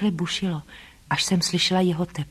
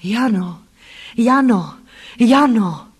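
calling a name, building louder and higher; urgent pleading call; 3 semitones higher, 6 dB louder, slower than usual. A narrator's reading. Distant voice of a beloved calls to her, pleading cry. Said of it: "který volal; úpěnlivě, bolestně, divě, strašidelně, naléhavě".

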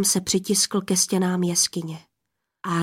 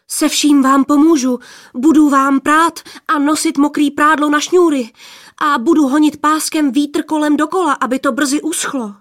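eager plea; 5 semitones higher, 6 dB louder than usual. A narrator's reading. Youth pleads eagerly.

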